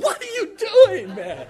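high, loud laughing yelp; mock alarm. Preacher mimicking shock at boys lifting their feet, high laughing yelp. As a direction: squeal it out half laughing.

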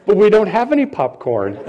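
laughing through the punchline. Real, not acted. Pastor retelling his wife's popcorn comeback, laughing. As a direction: giggle out the wife's retort.

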